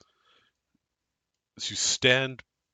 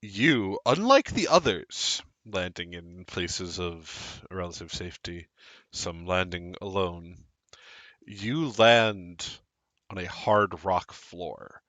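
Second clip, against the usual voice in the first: scene narration; 4 semitones lower, 4 dB quieter than usual. Acted. Game master narrates the scene.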